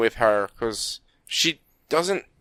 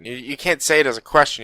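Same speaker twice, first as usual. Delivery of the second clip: ordinary talk, nothing marked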